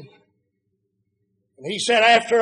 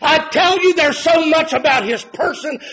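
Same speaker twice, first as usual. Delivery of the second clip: high, loud preaching cadence, hammering each "more"; full-force preaching; 6 semitones higher, 4 dB louder than usual. Acted. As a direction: preach it at full force, rising with every line. Preacher building to climax.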